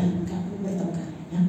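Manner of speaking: ordinary delivery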